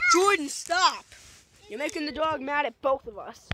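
loud exasperated telling-off; yelling at the dog. Real, not acted. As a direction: shout to stop the dog now. Scolding friend.

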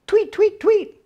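high chirpy tweets; birds chirping. Acted. Storyteller voicing birds joining the picnic, high chirps.

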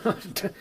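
brief amused laugh; a quick laugh at the crook's nerve; short laugh. Acted. Wounded Watson laughs at the counterfeiter's nerve.

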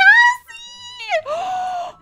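very high, loud drawn-out gasp; shocked gasp. Real, not acted. Reacts to in-game killing.

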